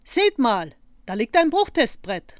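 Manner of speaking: excited shout-out; sudden call. A narrator's reading. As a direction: call out to the others, pointing out a find. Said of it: "als Ira plötzlich rief".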